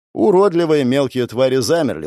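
plain reading voice

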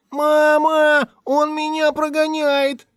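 put-on boy shouting to mother next door, high and slow; tattling shout. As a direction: tell on him at the top of your voice.

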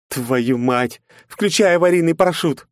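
panicked shout. Acted. Knight panicking, falling.

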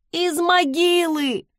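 high, drawn-out pitiful howl; plaintive wail. A narrator's reading. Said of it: "жалобно провыли в ответ".

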